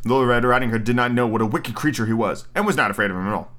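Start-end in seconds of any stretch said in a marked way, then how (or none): none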